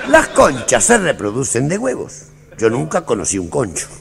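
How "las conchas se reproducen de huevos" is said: slow mock lecture. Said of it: "mock lecture, slow".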